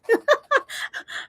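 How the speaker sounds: high-pitched laughter; laughing. Real, not acted. Streamer spotting someone being coached live, high laugh.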